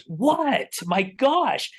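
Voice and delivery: high, loud startled reaction. Retold or imitated. Salesman jolted by customer's sudden yell, startled. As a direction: jump as if scared out of nowhere. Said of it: "scared the crap out of me".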